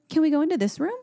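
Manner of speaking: put-on high eager child asking; bright little-kid question; toddler voice. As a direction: mimic a curious toddler. Daughter quoted.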